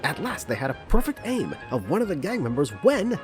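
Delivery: plain reading voice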